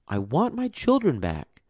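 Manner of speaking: high, desperate plea; pleading mother. Acted. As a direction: beg to be let in.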